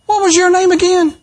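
very high, acted query; mock puzzled question. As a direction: ask it way up high, playing clueless. Acted clueless spouse asking name.

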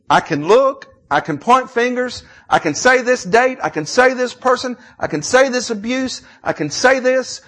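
loud, high Southern pulpit preaching; full-force sermon delivery; impassioned preaching. Real, not acted. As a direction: preach it out high and hard, leaning on key words.

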